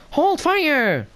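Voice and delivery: high-pitched mock droid order; droid command. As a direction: imitate a droid calling out, very high. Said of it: "at least Officer, Officer Droid".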